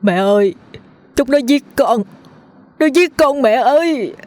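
put-on possessed teacher screaming for his mother in a girl's voice; wailing scream. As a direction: scream in terror for your mother. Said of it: "gào lên thảm thiết".

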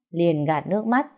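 ordinary delivery

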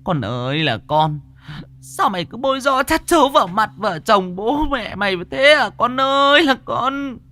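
high, loud wailing scold, dragging the calls; a mother lamenting and scolding her daughter. Acted. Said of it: "vừa ngồi vừa chửi con".

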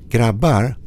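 Swedish: ropar högt; shouted call. Acted. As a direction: call the friends back across the dark. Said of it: "Jag skrek".

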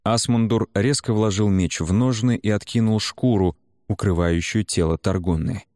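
plain reading voice

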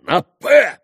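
loud, high furious yell; furious bark. A narrator's reading. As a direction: bark it in a rage. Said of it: "рявкнул в ярости Митя". (яростный рёв)

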